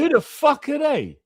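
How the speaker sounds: high, loud, dismissive put-on voice mocking outsiders; high, loud mock-snob voice scoffing at the upstarts; mocking the establishment. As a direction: sneer it like a snob who's never heard of them. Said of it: "That's how they look on us".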